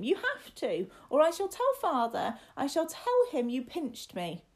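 put-on high, loud childish threat; demanding boy. Boy threatens to tell Father on his companion, high, loud demand. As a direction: threaten like an indignant little master.

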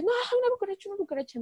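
high panicked cry about a cockroach; retold panic, first word shrieked high; panicked squeal. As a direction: squeal in mock panic, then drop. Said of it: "yo me puse como loca".

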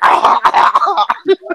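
high, loud mock gagging wail; imitated choking cry. Kid with pill shoved down throat, imitated choking cry.

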